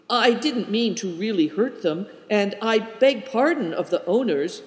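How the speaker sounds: ordinary delivery, nothing marked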